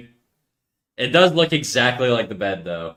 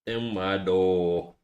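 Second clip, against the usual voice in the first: put-on [name] style accent; 5 semitones lower, 6 dB quieter, much slower than usual. Repeats the put-on line.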